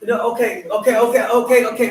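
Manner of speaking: loud placating okays. Real, not acted. Placating interrupter, louder.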